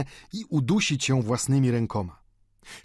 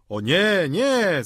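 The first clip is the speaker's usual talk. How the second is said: loud, high, drawn-out refusal; raised, insistent cry; collective outcry; 8 semitones higher, 5 dB louder, much slower than usual. A narrator's reading. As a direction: cry it out like a protesting crowd. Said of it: "zawołali wszyscy stołownicy".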